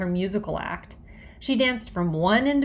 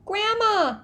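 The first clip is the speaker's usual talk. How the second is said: put-on high panicked child's call; cried out; 13 semitones higher than usual. A narrator's reading. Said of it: "Fudge cried".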